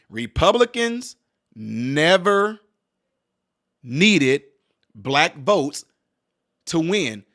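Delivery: slow, word by word, each word punched; deliberate slow repeat; slow emphatic repeat. Shown as a demonstration. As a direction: spell it out slowly with weight on every word. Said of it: "I repeat".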